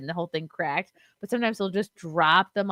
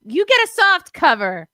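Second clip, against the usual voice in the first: high, loud playful teasing; 11 semitones higher, 9 dB louder than usual. Real, not acted. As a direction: rub it in playfully. Teasing a friend over the book.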